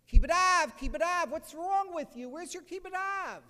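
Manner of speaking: high, very loud indignant shout, acted out; outraged rebuke. Rabbi stops a groom not helping his father, outraged shout. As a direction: yell it as a scandalized rabbi dressing someone down.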